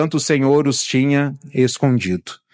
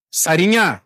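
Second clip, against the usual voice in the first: calling out. Retold or imitated. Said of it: "Olha o tom de voz".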